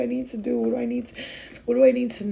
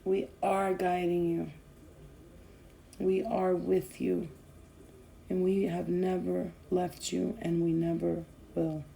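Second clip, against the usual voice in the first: channeled spirit message; 2 semitones lower, 6 dB quieter than usual. Acted. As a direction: deliver it like a calm voice from beyond.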